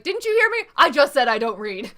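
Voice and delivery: loud, high, fed-up yell; exasperated shout. Retold or imitated. Buff Cheeto Puff character yelling at the chatterer, exasperated shout. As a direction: yell it at someone who won't stop talking. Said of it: "He's like".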